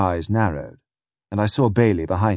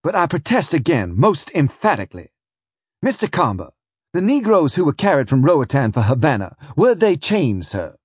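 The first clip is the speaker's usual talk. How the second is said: higher, louder insistent questioning; a prosecutor leaning hard on the witness; pressing prosecutor; 6 semitones higher, 4 dB louder than usual. Acted. Prosecutor presses the witness in court, insistent and higher.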